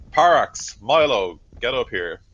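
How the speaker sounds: officer calling out sharply; yelled summons. Acted. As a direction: yell across to your men to come. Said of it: "turns around and yells".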